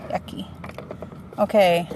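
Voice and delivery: ordinary delivery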